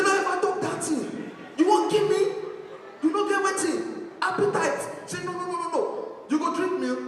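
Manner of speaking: high, loud panicked mother; frantic mama. Retold or imitated. Mama fussing over her sick son, high and frantic. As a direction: plead in a high fuss.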